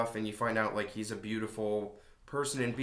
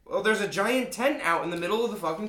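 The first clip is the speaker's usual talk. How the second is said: high voice; 8 semitones higher, 4 dB louder than usual. Retold or imitated. Voicing couple in movie.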